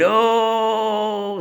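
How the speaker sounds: long high-pitched howl of pain; imitated drawn-out "oh" scream; mock scream. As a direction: wail it, hammed up. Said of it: "so he's screaming there".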